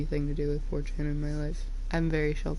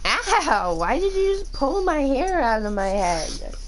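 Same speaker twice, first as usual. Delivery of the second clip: higher, louder indignant yelling; real pained outrage; 8 semitones higher, 3 dB louder than usual. Real, not acted. Owner yelling as her pets pull her hair. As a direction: yell at the pets in pained disbelief.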